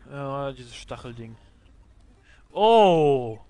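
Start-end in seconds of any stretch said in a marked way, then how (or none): 2.5-3.4 lauter, überraschter Ausruf; laut aufschrecken. Real, not acted. Boss taucht auf. (überraschter Ausruf)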